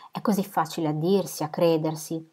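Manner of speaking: plain reading voice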